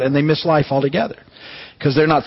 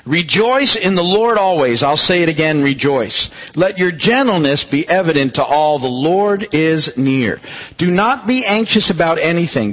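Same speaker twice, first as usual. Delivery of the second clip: raised, measured reading voice; scripture reading; 5 semitones higher than usual. Acted. A pastor reads Philippians to his church, raised and measured. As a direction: read it out like a pastor.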